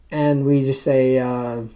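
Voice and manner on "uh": ordinary delivery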